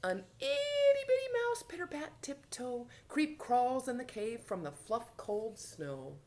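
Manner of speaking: high, dainty storytelling; tiny-mouse narration. A narrator's reading. Teacher reads of itty bitty mouse, high dainty narration. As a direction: start tiny and high, easing down.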